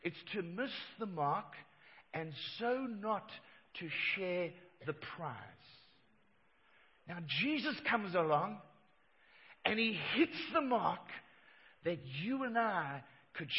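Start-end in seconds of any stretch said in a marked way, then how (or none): none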